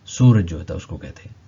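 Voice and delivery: ordinary talk, nothing marked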